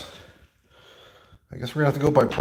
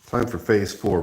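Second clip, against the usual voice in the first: ordinary talk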